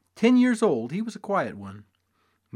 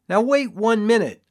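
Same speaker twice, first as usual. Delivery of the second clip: loud protest; 8 semitones higher, 8 dB louder than usual. A narrator's reading. Showman protests loudly.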